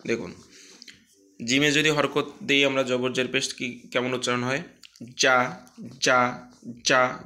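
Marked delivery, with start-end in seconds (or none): 5.2-7.2 "যা" with a hard, pressed j; firm pronunciation. Shown as a demonstration. Teacher drilling vowel marks, hard crisp "যা". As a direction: punch out a firm, short "যা". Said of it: "শক্ত করে উচ্চারণ".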